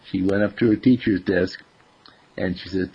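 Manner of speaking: usual speaking voice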